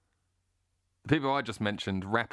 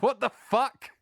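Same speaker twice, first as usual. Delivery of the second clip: slow, loud, higher exasperated what the fuck; exasperated outburst; 13 semitones higher, 6 dB louder, much slower than usual. Real, not acted. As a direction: let the frustration burst out slowly. Exasperated rant about the media.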